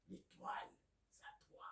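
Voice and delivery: soft whisper, very quiet; whispered secret. Real, not acted. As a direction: confide it like a gift in a whisper. Intimate whisper to the listener.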